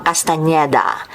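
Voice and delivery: ordinary talk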